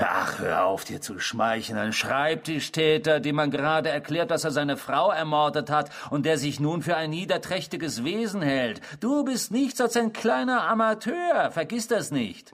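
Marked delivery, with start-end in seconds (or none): 1.8-12.5 höher, spöttisch und herablassend; verhöhne ihn als kleinen Amateur; verächtlich. Acted. (höhnisch herablassend)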